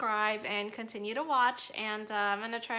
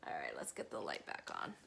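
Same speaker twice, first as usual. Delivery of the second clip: soft unvoiced whisper; 10 dB quieter than usual. Real, not acted. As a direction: breathe it out in a whisper. Whispers while turning light on.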